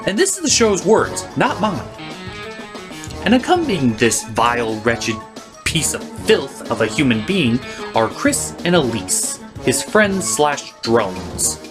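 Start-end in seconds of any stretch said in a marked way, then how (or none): none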